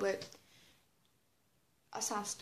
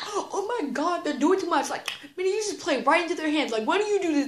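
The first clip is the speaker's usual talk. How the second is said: higher-pitched mock scolding; exasperated mom; 5 semitones higher than usual. Mom complaining to her suspended daughter, exasperated. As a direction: fret and lecture like an exasperated mother.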